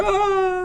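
high cry of a man falling off a ledge; mock high yelp as he drops; falling scream. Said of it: "You hear".

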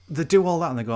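ordinary talk, nothing marked

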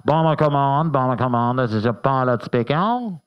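mock posh RAF pilot on the radio. Bomber pilot radios the crew in a posh English accent. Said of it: "You can tell I'm the pilot 'cause I'm posh".

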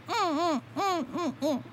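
a man caught talking to himself, humming; high muffled humming whimper. Retold or imitated. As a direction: hum nervously with closed lips. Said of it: "all of a sudden I hear".

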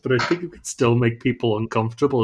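ordinary talk

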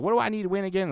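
high, innocent folksy coach; imitating Ted Lasso. Ted Lasso playing dumb to his darts opponent, high folksy voice. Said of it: "And he's like".

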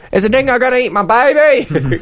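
hammed-up high Australian accent, badly faked. S1 mocking tourists with a bad Australian accent. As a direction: fake a terrible Australian accent. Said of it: "worst Australian accent I've ever heard".

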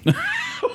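high sad villain cackle; evil laugh. Pretend. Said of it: "Was that a sad, evil laugh?".

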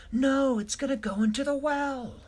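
shrill-free high panic on no; high alarmed cry. A narrator's reading. Older Dashie watching camera fly toward the well, high alarm.

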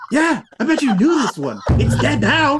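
high-pitched, crowing taunt. Acted. Player taunts with a bag of dragon parts, high crowing. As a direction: crow it high, showing off the kill.

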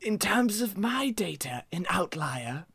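scholarly character voice. Acted. NPC reads out his notes.

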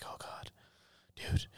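quiet, frightened near-whisper; hushed panic of a scared brother. Retold or imitated. Brother on the phone, afraid in the bushes: hushed and scared. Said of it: "actual fear in his voice".

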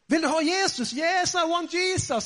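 high-pitched, excited re-enactment; acted eager exchange. Acting out the eager exchange with Maggie.

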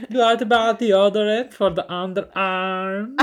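put-on slow, loud, high Polish accent; drawn-out foreign caller, Polish accent. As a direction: stretch out each question as the Polish prank caller. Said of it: "I'd go".

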